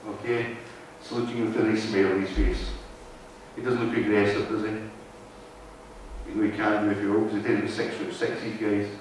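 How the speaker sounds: usual speaking voice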